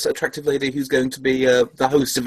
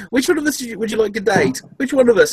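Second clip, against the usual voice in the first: higher, eager mock question; mock fanboy question; 7 semitones higher, faster than usual. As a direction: ask it like a giddy schoolboy.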